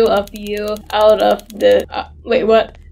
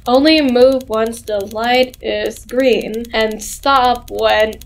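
slow, drawn-out game dialogue; measured, stretched-out read-aloud; odd reading voice; slower than usual. Acted. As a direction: read it out slowly and deliberately. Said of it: "Why do I read like this?".

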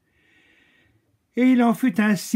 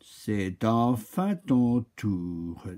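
very slow calm oak; 6 semitones lower, 5 dB quieter, much slower than usual. Acted. Oak finally calls her.

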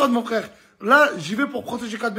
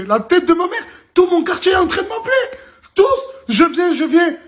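laughing, louder and higher; excited laughing; 7 semitones higher, 4 dB louder than usual. Real, not acted. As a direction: laugh while blurting it out.

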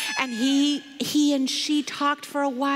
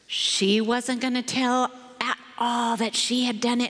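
ordinary delivery

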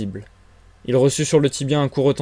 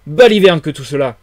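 loud burst on the first word; indignant exclamation; 3 semitones higher, 10 dB louder than usual. A narrator's reading. Invisible man rejecting the accusation, exclaiming. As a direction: throw it out as a loud dismissal. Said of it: "s'écria l'homme invisible".

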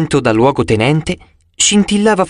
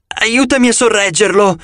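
higher, strained under weight; straining groan; 7 semitones higher than usual. A narrator's reading. Annabeth straining under the rock, groaning for help. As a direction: push the words out while holding a great load. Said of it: "gemette Annabeth".

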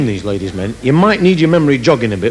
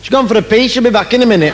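higher, loud brassy woman; hammed-up loud girl; brash girl at the bar; 11 semitones higher, 4 dB louder, faster than usual. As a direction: shout back like a blunt barmaid. Girl answers the ladies' man.

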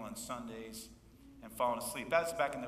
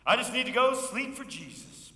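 mock pious excuse; 9 semitones higher, 8 dB louder than usual.

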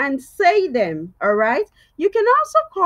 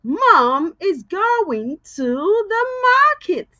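slow model sentence; 3 semitones higher, slower than usual. Shown as a demonstration. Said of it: "Another one".

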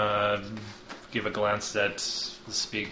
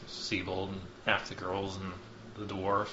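ordinary delivery

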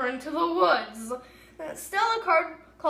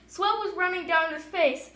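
plain reading voice

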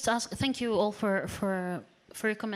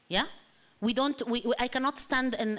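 ordinary talk

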